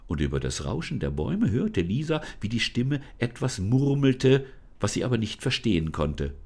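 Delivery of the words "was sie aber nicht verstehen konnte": ordinary delivery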